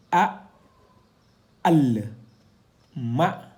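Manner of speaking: each Arabic syllable spoken alone, loud and clearly cut; Arabic syllables read out. Shown as a demonstration. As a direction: read the letters one by one like a Quran teacher. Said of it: "nous avons un la ici". (syllabes arabes détachées, fortes et nettes)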